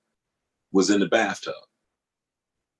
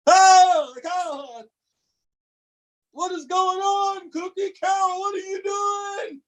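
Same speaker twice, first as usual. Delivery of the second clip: much higher, shrieking father jolted out of hot water; panicked yell. Retold or imitated. Father in the tub yelling at his wife, panicked shriek. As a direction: yell like a scalded man leaping up.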